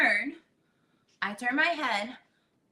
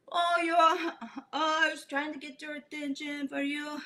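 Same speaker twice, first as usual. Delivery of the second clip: laughing guide; 4 semitones higher than usual. Retold or imitated. Guide teasing a spinning snorkeler, mock laughing voice. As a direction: imitate him laughing through his words. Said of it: "he's all laughing and like".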